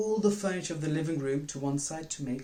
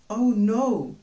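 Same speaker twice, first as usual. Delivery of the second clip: high, loud frightened gasp of words; a child seeing the crash; sudden alarm, much louder; shocked cry; 8 semitones higher, 9 dB louder than usual. Acted. Child sees broken dishes.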